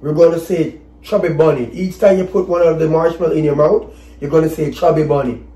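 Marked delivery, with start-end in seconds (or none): none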